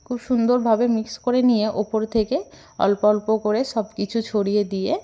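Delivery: ordinary talk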